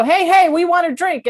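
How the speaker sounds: playful, high-voiced demand; demanding customers. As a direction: pipe up for the bartender's attention. Joke: beavers demand a drink.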